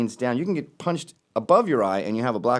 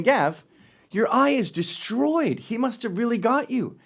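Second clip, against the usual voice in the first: higher, slow mock viewer voice; viewer's question; 10 semitones higher than usual. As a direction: voice a concerned fan. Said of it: "You're saying".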